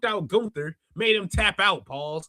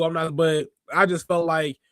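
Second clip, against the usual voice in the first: ordinary talk, nothing marked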